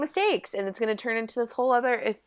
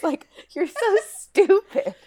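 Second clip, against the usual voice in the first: exasperated; 10 semitones higher, 4 dB louder, slower than usual. Real, not acted. Exasperated insult.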